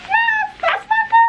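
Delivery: very high, thrilled shriek; excited squeal. Pretend. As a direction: squeal it like a proud fan. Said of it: "I would be like".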